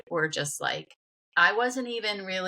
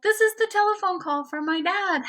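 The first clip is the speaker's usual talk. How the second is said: very high, thrilled retold exclamation; re-enacted astonishment, pitched way up; amazed realization; 14 semitones higher, 3 dB louder than usual. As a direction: squeal it as a sudden revelation. Retells amazed realization on the mountain.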